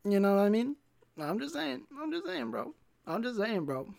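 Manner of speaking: playful falsetto needling; silly high voice. Pretend. Comic needling gym bros who post videos, silly falsetto. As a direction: climb into a small, high squeak. Repeats catchphrase in rising silly voice.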